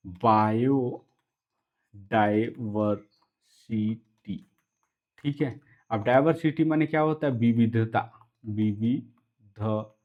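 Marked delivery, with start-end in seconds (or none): 0.1-4.5 very slow, broken into syllables; drawn-out dictation pace. Shown as a demonstration. Teacher sounds out the term. 8.5-10.0 विविधता very slow, as वि-वि-ध-ता with gaps; विविधता dictated piece by piece, softly spaced out; syllable by syllable. Shown as a demonstration. Teacher repeats it in syllables.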